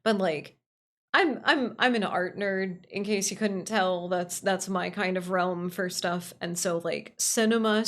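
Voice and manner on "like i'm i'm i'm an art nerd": ordinary talk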